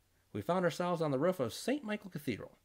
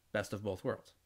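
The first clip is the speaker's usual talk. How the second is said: ordinary talk, nothing marked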